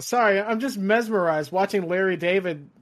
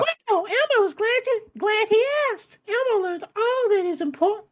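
very high, fast puppet voice; chirpy little red monster; Elmo voice. Retold or imitated. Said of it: "I'm not doing that in the Elmo voice".

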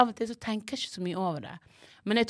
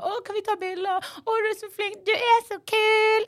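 gushing fan; 13 semitones higher than usual. Retold or imitated. Fans crowding the MC after a show, gushing high. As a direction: squeal it like an excited fan.

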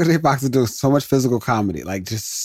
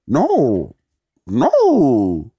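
a light cartoon-dog "No, no" in Scooby-Doo style. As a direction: whine it like a scared cartoon dog. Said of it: "Now I just became Scooby-Doo".

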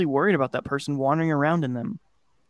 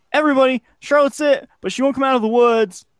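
high, louder call to a group; narrated yell; 11 semitones higher, 4 dB louder than usual. A narrator's reading. Kid alerting the other players, yelling. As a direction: call out news across the yards. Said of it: "joining in my yell".

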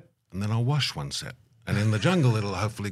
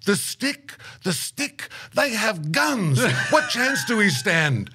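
very high-pitched, drawn-out exasperation; hammed-up imitation of a flabbergasted elder; incredulous outburst; 10 semitones higher, slower than usual. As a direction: throw the words back in squeaky disbelief. Elder scoffs, incredulous, at the stick.

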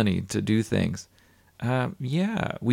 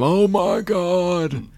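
higher, slow, drawn-out mock scream; imitating a screaming character; 8 semitones higher, much slower than usual. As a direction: stretch out a hammed-up "oh my God". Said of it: "likes to scream".

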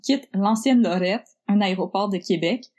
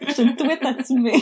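laughing; 4 semitones higher than usual. Real, not acted. Laughing at own word.

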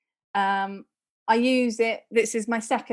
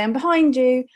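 louder polite call-out; mock shout, raised in level; demonstrated shout; 3 semitones higher, 7 dB louder than usual. Shown as a demonstration. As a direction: call out a warning to someone ahead. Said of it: "or shout and say".

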